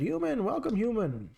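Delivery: high, squeaky bug voice. Retold or imitated. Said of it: "I wish I could do, like, a bug voice".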